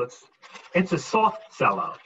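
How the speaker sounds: normal talking voice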